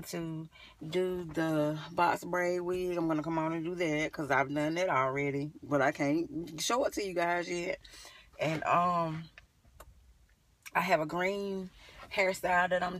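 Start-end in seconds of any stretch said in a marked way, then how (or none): none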